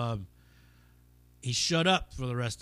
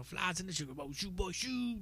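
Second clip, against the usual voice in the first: soft, higher rambling hobo voice; a hint of the movie hobo, quieter; hobo impression; 7 semitones higher, 7 dB quieter than usual. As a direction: lightly croon it like the train hobo. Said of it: "the fucking hobo that's on the train".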